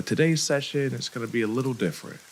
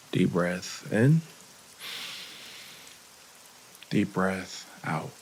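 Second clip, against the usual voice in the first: guided meditation. Real, not acted. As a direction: lead the breathing unhurriedly, with long gaps.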